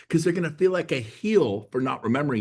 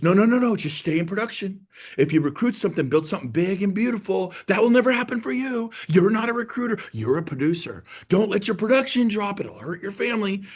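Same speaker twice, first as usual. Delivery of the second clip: higher-pitched, urgent discouraging; mock manager's warning; 7 semitones higher than usual. Manager warning an agent to stay in production, mock alarm. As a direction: imitate a boss scaremongering, pitched up.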